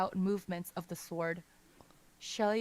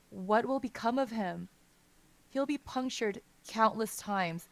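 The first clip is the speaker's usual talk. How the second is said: ordinary delivery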